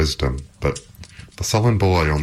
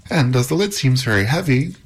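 higher, mildly excited offer; eager cry; 9 semitones higher than usual. A narrator's reading. Epimetheus offering to help with the lid, crying out. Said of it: "cried Epimetheus".

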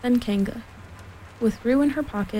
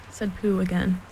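ordinary delivery

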